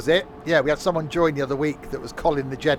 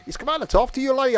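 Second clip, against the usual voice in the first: high put-on Scottish accent; a Scot, pitched high; 12 semitones higher, 4 dB louder, faster than usual. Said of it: "a Scot living in Wol".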